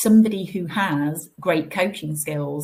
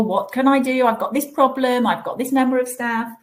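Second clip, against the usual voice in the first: higher, slightly louder voice of managers bringing problems; higher-pitched acted plea for help; imitating needy managers; 8 semitones higher, 3 dB louder than usual. Voicing managers seeking HR help.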